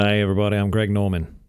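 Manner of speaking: put-on light Australian accent; a hint of an Australian accent; Greg Norman Australian accent. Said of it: "can you do an Australian accent".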